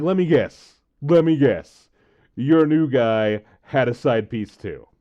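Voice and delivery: loud, mocking taunt, slowing at the end; sarcastic taunt. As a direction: rub it in with scorn.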